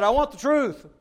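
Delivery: high, loud mock of the king; hammed-up royal whine. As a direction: parody his empty demand. Mocking the king's claim.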